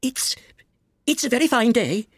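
high, hesitant, stammering opener; timid voice. A narrator's reading. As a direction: venture it nervously, pitched high. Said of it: "said a timid voice".

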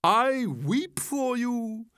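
high, slow theatrical lament; hammed-up pity without real sobs; weeping Walrus. A narrator's reading. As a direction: wail it grandly, long-drawn. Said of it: "With sobs and tears".

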